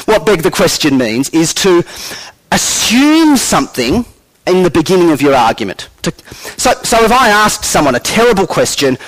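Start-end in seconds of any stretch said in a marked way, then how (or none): none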